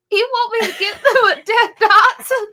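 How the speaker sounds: high, loud and cracking up; laughing disbelief. Retold or imitated. To her sister about the dog, a laughing high disbelieving squeal. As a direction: squeal it through laughter, incredulous. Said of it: "I was like".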